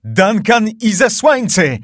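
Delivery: high, loud, slow cry of despair. Acted. Glenarvan sees the convicts aboard, despairing cry. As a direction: cry out in horror.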